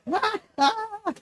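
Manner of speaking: high stoned giggle. Real, not acted. Laughing at own joke.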